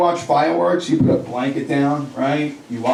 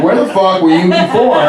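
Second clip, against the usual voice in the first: loud, higher mock outrage; exasperated parent; 5 semitones higher, 6 dB louder than usual. Parent to kids suddenly eating, loudly exasperated. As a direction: demand it loudly in comic disbelief.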